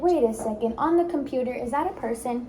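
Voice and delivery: put-on bright, upbeat coaching of little kids; teacher voice to kids. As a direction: cheer them on like a speech therapist. Therapist coaching young kids.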